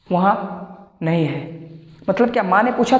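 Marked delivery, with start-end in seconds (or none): 0.1-1.7 put-on slow child's reply. Acted child answering mother.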